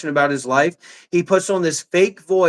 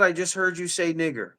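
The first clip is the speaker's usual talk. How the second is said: ordinary talk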